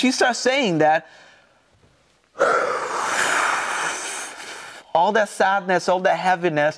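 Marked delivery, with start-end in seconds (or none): 2.4-4.5 long, soft exhale, acted out; demonstrated sigh of relief; sigh of release. Shown as a demonstration. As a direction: breathe all the heaviness out. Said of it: "so released".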